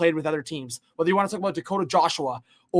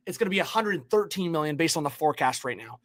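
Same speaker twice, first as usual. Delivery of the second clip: ordinary delivery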